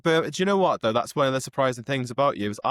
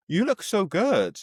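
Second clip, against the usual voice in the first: gushing compliment; 7 semitones higher, slower than usual. Real, not acted. Host compliments guest.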